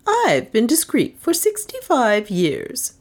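slow, mildly tart and sniffy; a touch of an old lady's disdain; aunt refusing the doctor's advice, lightly sniffy; with a sniff. A narrator's reading. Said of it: "she said with a sniff".

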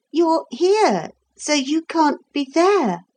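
put-on high-pitched puzzled child; bewildered. A narrator's reading. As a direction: protest in high-voiced confusion. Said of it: "more and more bewildered".

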